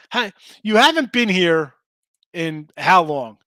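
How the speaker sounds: ordinary talk, nothing marked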